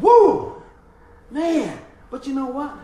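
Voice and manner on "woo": high loud woo; joyful whoop. Retold or imitated. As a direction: whoop with delight. Child celebrating riding bike.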